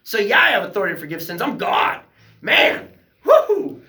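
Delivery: high, loud and triumphant; excited Jesus voice. Retold or imitated. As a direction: cheer it out like a victory whoop. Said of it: "He's like".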